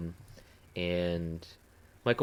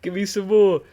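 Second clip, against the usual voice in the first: goofy sing-song voice; 7 dB louder, slower than usual. Pretend. Jokey chant at Mikey Moore.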